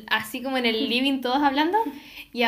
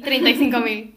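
louder ironic repeat of "treinta y cinco mil"; ironic echo; 7 dB louder than usual. Retold or imitated. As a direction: scoff at the price.

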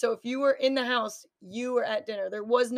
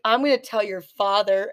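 imitating mom's scolding, louder; mock mom threat; 7 dB louder than usual. Daughter imitating mom threatening her kids, mock scolding. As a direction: play at a stern telling-off. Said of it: "laughing at you and trying to yell at you".

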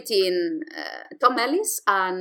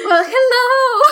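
loud greeting; 12 semitones higher, 7 dB louder, slower than usual. Pretend. Mock dramatic greeting.

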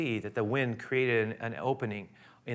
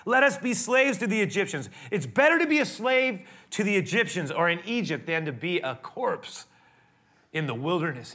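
angry complaint; 5 semitones higher, 3 dB louder than usual. Retold or imitated. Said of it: "And they said to Moses".

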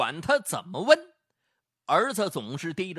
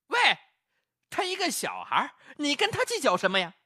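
impatient defending; 6 semitones higher than usual. Acted. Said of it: "也急了".

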